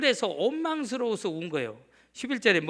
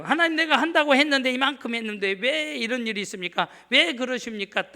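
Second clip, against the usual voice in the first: loud, higher, complaining cry to God; aggrieved protest; 5 semitones higher, 5 dB louder than usual. Retold or imitated. Israelites arguing with God after their sacrifices, loudly aggrieved. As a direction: demand an answer, wronged and indignant. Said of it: "따지고".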